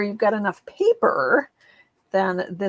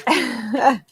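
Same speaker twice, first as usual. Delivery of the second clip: nervous laugh; 8 semitones higher than usual. Real, not acted. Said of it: "I don't know why I'm nervous".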